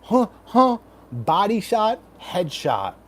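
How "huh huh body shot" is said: sharp high punch grunts; boxing grunts. Shown as a demonstration. Shadowboxing grunts.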